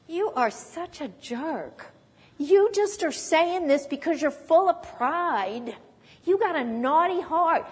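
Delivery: mock an older brother's reproach; scolding brother. Brother putting David down before the Goliath fight, scolding. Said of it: "one of his brothers said to him".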